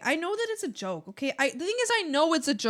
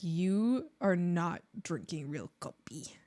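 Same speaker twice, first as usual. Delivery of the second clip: snobby gatekeeper; 6 semitones lower, slower than usual. Retold or imitated. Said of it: "be like".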